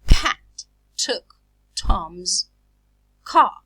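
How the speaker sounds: very slow, loud "Pat took Tom's car", a puff after P, t and c; aspirated plosives. Shown as a demonstration. Teacher asking students to listen, "Pat took Tom's car" slowly and forcefully aspirated. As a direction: punch out each word of "Pat took Tom's car" with breathy initial plosives. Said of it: "I aspirate them because they occur initially".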